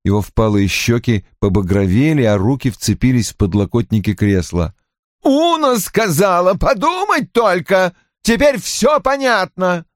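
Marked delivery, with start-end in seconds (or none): 5.2-9.8 high-pitched, drawn-out indignation; scornful outburst. A narrator's reading. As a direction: rant with bitter scorn. Roy rages on after crying out. (возмущённо, с едкой издёвкой)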